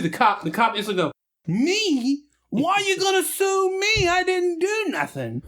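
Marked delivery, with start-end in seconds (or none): 1.5-5.4 squeaky high, slow pleading denial; whiny high-pitched cop protesting innocence; mock scared cop.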